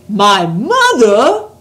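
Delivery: loud, slow astonished giant; astonished cry. A narrator's reading. Giant astonished at Sophie's question, loud and slow. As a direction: recoil at the very idea. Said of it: "cried the B F G".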